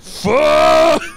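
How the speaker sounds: loud, high mock scream. Capping the friend's joke, hammed-up scream. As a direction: belt it out long and high. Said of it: "And he's screaming".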